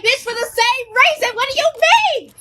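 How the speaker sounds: rising, high-pitched indignation; mock exasperated retort. Student answers nurse asking why she is back, mock exasperation. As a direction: climb in pitch with disbelief, acting it out.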